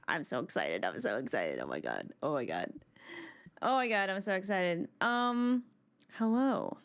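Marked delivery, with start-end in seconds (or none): 0.1-1.6 very low, goofy deep voice; mock deep voice. Deep voice then own voice. 1.6-2.7 very low, softer, goofy deep voice; mock deep voice. Deep voice then own voice.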